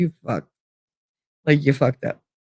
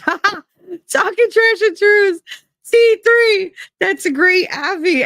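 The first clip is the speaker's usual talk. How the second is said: high, excited mock-hype voice with laugh; Benny Johnson impression. Streamer mimics a pundit shouting her name, high and hyped. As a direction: squeal it like an overexcited host. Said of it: "Benny Johnson where he goes".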